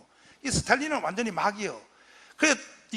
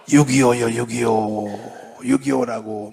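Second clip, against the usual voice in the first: drawn-out answer; 12 semitones lower, 6 dB louder, slower than usual. Shown as a demonstration. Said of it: "일어난 것이 뭐예요?".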